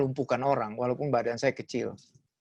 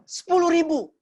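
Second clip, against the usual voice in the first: much higher, louder cheeky demand; hammed-up greedy thug; thug raising the price; 12 semitones higher, 4 dB louder than usual. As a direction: bump the price up, pushy and brazen.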